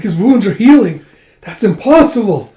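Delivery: high, loud shocked exclamation; aghast disbelief. Acted. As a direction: cry out as if witnessing the impossible. Soldiers shocked at healing wounds.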